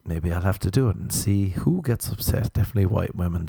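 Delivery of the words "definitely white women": ordinary delivery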